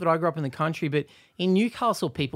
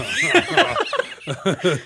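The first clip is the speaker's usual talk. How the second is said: laughing; 8 semitones higher, 4 dB louder than usual. Real, not acted. Laugh at story.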